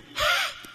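open-mouthed audible breath; demonstrated breathing. Shown as a demonstration. Preacher showing how Jesus breathed on Peter, audible breath. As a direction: breathe out audibly, without blowing. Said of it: "Breathing's more".

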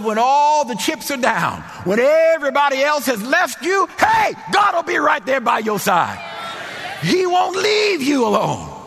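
building preaching shout. Real, not acted. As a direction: build it up until you're shouting.

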